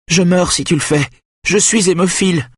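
high, desperate moaning plea; gémissement suppliant. A narrator's reading. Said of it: "gémit Benjamin". (supplication gémie, très aiguë)

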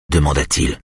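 usual speaking voice

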